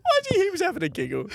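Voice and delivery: high, quick, tearful giggly speech; laughing while talking. Real, not acted. Prankster recalling the driver's giggle, cracking up. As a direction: talk through helpless laughter.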